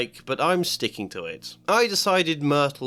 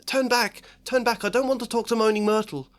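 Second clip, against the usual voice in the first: put-on high, fast, urgent girl's voice; urgent schoolgirl; 11 semitones higher, 3 dB louder, faster than usual. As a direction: hurry the friends away in a higher girl's voice. Hermione urges retreat, fast.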